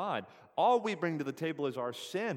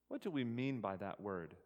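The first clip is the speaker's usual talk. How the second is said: ordinary talk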